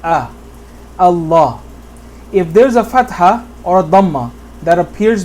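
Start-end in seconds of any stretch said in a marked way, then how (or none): 0.0-1.6 "Allah" with heavy dark l and back vowel; thick lam. Shown as a demonstration. Teacher explaining fatha before the lam, thick pronunciation. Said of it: "you make it thick".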